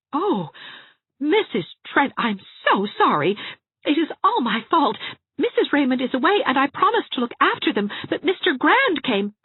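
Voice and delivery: high, loud, hurried apology; flustered apology. A narrator's reading. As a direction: pour out sorry in a rush. Anne apologising in distress.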